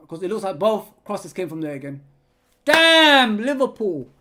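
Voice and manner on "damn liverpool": a loud, higher burst of annoyance; frustrated curse. Real, not acted. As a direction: spit it out in disgust. Frustrated at own team's defending.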